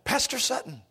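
high, surprised waitress voice; a lightly voiced waitress, pitched high. Pastor imitates the waitress recognizing the name, high and surprised.

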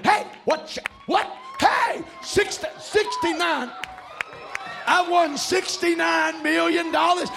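high, stammering disbelief bursting out; acted giddy excitement. Preacher acting out lottery winner in pajamas, giddy excitement. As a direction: play a winner losing all composure.

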